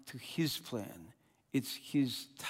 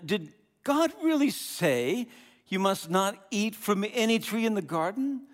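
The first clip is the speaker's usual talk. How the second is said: sly serpent; 6 semitones higher, 4 dB louder than usual. Acted. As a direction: lean on really like a tempter planting doubt. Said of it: "He said to the woman".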